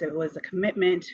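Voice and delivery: ordinary delivery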